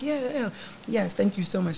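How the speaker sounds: soft polite phone voice; sweet professional phone manner; customer service voice. Retold or imitated. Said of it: "so I switched my voice up".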